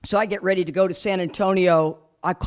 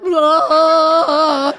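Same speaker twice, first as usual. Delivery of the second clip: mock gasp; 9 semitones higher, 8 dB louder than usual. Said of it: "Me, I'm like".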